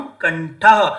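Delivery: कंठः said with a clear breathy visarga echo; sutra recitation. Shown as a demonstration. Teacher recites sutra ending to students. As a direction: sound out कंठः with the final ह audible. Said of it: "सबसे पहला सूत्र है".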